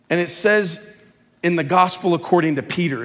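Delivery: usual speaking voice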